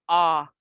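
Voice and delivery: AH pulled out on its own, firm; one clear, standalone AH; isolated middle sound. Shown as a demonstration. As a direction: sound out AH separately from the word. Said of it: "Did you hear it in the middle".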